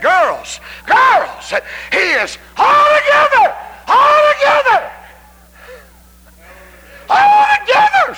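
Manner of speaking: very high, loud drawn-out shout; ecstatic shout. Retold or imitated. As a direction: cry altogether out in rapture. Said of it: "she just said".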